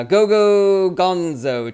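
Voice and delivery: loud, slow, stretched name called out; drawn-out name call. Real, not acted. Streamer calling out a commenter by name, slow and loud. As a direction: savour the name as you call it out. Reading out a username.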